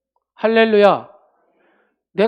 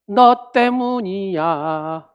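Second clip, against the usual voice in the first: slow, louder accusing voice; blaming accusation; 5 dB louder, much slower than usual. Retold or imitated. Adam blaming his wife, slow and accusing. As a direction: drag out the blame. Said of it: "시작".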